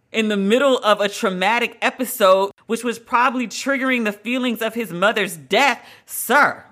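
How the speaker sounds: ordinary talk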